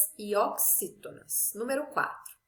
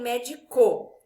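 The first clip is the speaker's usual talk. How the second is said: "médico" wrongly stressed on the last syllable, medi-CÓ; misplaced final stress; 6 dB louder, slower than usual. Shown as a demonstration. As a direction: throw the weight of "médico" onto the final CO. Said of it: "nem médico".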